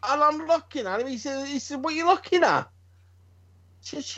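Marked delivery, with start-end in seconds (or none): 1.8-2.7 challenging son. Retold or imitated. Son's challenge, retold.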